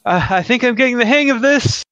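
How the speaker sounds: high-pitched, earnest character voice; eager young hero. Acted. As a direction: offer help in a high voice.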